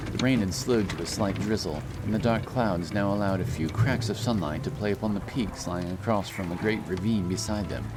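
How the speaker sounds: usual speaking voice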